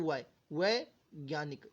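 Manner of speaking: वै held apart, then वैज्ञानिक said very slowly; slow pronunciation demo. Shown as a demonstration. Teacher to students, slowly sounding out वैज्ञानिक. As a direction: stretch वै before the full वैज्ञानिक. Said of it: "ये वी का क्या होता है".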